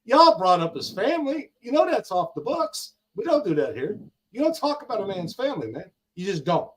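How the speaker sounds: retold rebuke to critics about a man's family; heated scolding. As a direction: scold them for dragging in family. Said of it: "I'm like".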